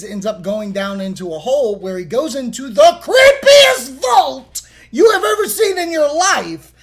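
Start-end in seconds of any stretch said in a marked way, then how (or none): 2.7-6.7 excited. Acted. Hyping the creepy vault.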